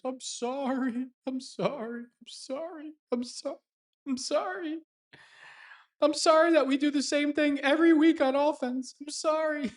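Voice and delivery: put-on grovel like a sniveling kid; mock whiny apology. Mocking a quarterback apologizing to fans. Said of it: "Aaron Rodgers".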